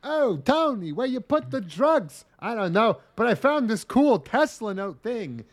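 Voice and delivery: put-on failed Italian-American accent, slow and halting; put-on mobster with big rise-and-fall swoops; Christopher Walken. Mafia guy asking Tony about drugs, accidental celebrity-like halting voice. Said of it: "without sounding like Christopher".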